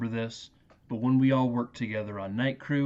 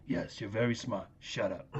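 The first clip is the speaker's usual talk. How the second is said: dry grandpa; 2 semitones lower, 5 dB quieter than usual. Retold or imitated. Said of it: "And then Columbo".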